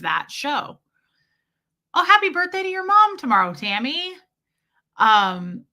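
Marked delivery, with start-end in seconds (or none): 1.9-4.1 high, loud and fast burst of cheer; light up for the viewer's mom; excited birthday greeting. Real, not acted.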